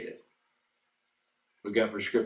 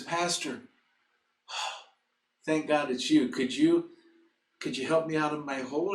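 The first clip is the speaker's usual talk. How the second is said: lightly acted relief, higher voice; relieved man in trouble; 6 semitones higher than usual. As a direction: sigh first, then a mild higher-pitched plea. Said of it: "And the guy says".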